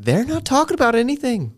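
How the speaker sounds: high-pitched exasperated self-quote; acted whiny protest; mock complaint. Retells own complaint about podcasts.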